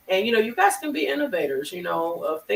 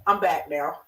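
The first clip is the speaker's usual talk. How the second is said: usual speaking voice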